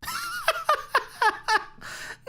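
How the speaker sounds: high, shrill burst of laughter; mad laugh. A narrator's reading. Basil laughing madly at the idea Lucy is no killer. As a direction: cackle in disbelief. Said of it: "Basil bursts into laughter".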